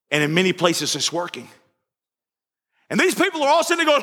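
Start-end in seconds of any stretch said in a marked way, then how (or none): 2.9-4.0 higher, fervent sermon voice, driving it home; preaching at full force. Acted. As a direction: preach it to the whole church.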